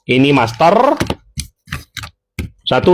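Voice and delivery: ordinary talk, nothing marked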